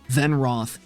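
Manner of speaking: ordinary delivery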